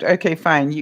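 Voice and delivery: ordinary delivery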